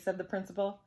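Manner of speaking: ordinary delivery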